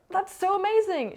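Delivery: high-pitched delighted imitation; excited coach. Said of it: "she was very excited for me".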